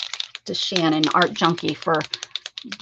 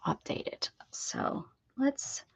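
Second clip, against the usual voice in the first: soft unvoiced whisper; 6 semitones higher, 11 dB quieter, slower than usual. Real, not acted. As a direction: whisper it under your breath. Whispering while drawing.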